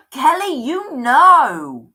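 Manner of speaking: high, loud, urgent plea; insistent urging. Real, not acted. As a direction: press her hard to admit it.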